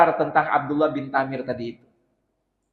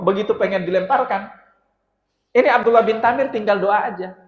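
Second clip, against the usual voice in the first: usual speaking voice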